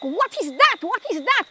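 high, startled repeated question; re-enacted shocked questioning; retold alarm. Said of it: "And I was like".

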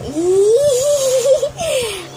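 high, teary laugh of joy; laughing near tears; emotional laugh. Real, not acted. Overjoyed reaction to the song.

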